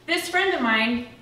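usual speaking voice

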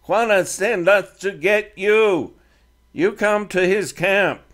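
put-on a defiant teen speaking halting English; Comanche boy leader.

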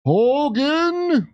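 high, drawn-out comic call; mock call. Comedian reacting to the clip, mock high call. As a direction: swoop up high and hold it.

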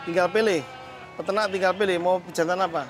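ordinary talk, nothing marked

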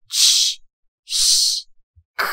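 "ch", "sh", "k" as three separate isolated sounds. Shown as a demonstration. Teacher shows students the three CH pronunciations, sounded separately. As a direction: say "ch", then "sh", then a bare "k", pausing between. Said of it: "C H它有三种发音".

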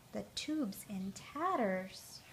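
slow hushed whisper. A narrator's reading. Narrator reading to children whispers the sad news. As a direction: breathe it out slowly with no voice. Narrator dramatizes ruined swim tube.

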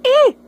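short barked "Eh!" for the fish; a loud, short pretend fish cry; mock fish shout. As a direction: yell a quick "Eh!" for the fish. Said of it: "He's shouting right now".

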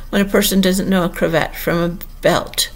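ordinary delivery, nothing marked

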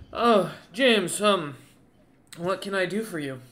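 exhausted commander greeting James; tired Houghton voice. Acted. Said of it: "as he looks up at you and goes".

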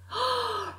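sharp high frightened gasp. Acted. Fox gasping at roasted fox, sharp high gasp.

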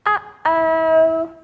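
lightly worried sing-song uh-oh; a playful worried uh-oh. Shown as a demonstration. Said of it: "ready with an uh-oh".

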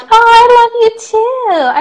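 loving, high-pitched coo; gushing affection. Real, not acted. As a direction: melt at the fan's sweet message. Gushing reply to a fan's message.

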